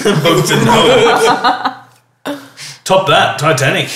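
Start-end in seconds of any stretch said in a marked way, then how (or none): 0.0-1.8 laughing repeat. Real, not acted. Repeating a friend's joke, laughing.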